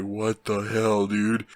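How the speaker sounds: slow, mildly dumbfounded; stunned awe. Retold or imitated. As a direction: imitate slack-jawed disbelief, drawn out. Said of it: "just in awe of what's going on".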